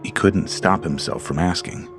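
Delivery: ordinary delivery, nothing marked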